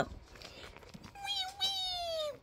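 tiny high, soft wailing; pretend toy crying. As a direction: squeal out a little mock cry. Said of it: "Don't cry".

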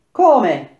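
sharp, loud and high outcry of disbelief; shouted question. Acted. Penthesilea shouting back at the accusing narrator, a loud stunned cry. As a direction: fling the word back as a shout. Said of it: "gridò Pentesilea".

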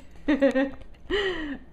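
sheepish giggle; embarrassed laugh. Real, not acted. After fumbling her thanks, a sheepish giggle.

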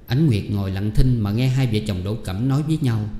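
ordinary delivery, nothing marked

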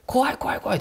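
high, frightened repetition; panicked. Retold or imitated. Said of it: "ってテンパってるらしくて".

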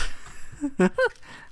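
high burst of laughter. Real, not acted. Gamer laughing at spawning in the fireplace, high laugh. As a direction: crack up briefly.

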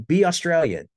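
lightly voiced rapper character scolding; imitating Al Pacino. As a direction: bark it like the movie character telling him off. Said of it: "So you see Al Pacino like".

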